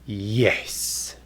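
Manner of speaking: drawn-out low, soft "Yes"; very slow. Shown as a demonstration. Teacher answering a question as a demo, very slow. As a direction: stretch "Yes" out reluctantly. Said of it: "say them very slow".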